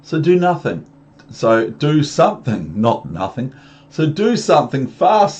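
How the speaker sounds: loud, high storybook urgency; urgent fish. Acted. Reader voicing the fish's order to act, urgent. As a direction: raise the voice, pressing for action.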